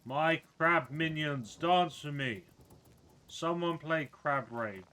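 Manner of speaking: loud, booming mock-grand wizard proclamation; hammed-up self-proclaimed crab wizard; pompous wizard. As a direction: lord it over the crab minions. Wizard commands crabs.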